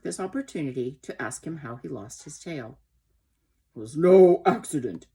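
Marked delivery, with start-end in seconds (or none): 3.7-5.1 put-on loud, indignant old horse; indignant snort. A narrator's reading. Old horse answers how he lost his tail, indignant snort. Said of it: "he snorted".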